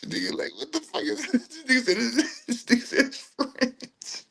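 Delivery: high, squeaky talk through laughter; words breaking up into real laughter; helpless laughing. Real, not acted. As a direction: crack up while getting the words out. Cracks up at his own joke.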